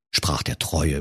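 ordinary delivery

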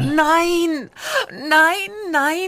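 exasperated cry. Acted. Santa sees too many presents.

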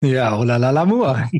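mock French charmer; French accent. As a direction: purr it like a French lover. Jokey French flirt.

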